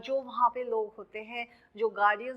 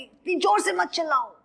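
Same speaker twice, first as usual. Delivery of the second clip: mock shout; 7 semitones higher, 4 dB louder, faster than usual. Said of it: "कहते हैं ना".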